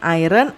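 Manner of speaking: "iron" misread as "AI-ren"; wrong pronunciation. Shown as a demonstration. Teacher warning pupils off a misreading, "iron" wrongly said "eye-ren". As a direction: say "iron" with the R voiced before an "en" ending. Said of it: "bukan iron".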